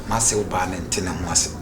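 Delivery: usual speaking voice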